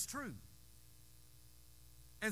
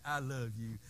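cooing to a pet; 7 semitones lower than usual. Retold or imitated. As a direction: croon it to your old cat. Cooing to the cat.

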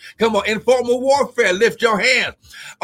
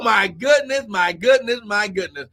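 ordinary delivery

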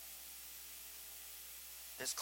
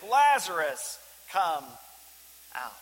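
Jesus calling Lazarus out of the tomb, loud; loud command; 8 dB louder, slower than usual. Acted. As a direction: call "Lazarus, come out" loudly and commandingly. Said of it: "Jesus called in a loud voice".

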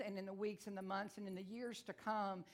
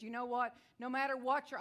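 ordinary talk, nothing marked

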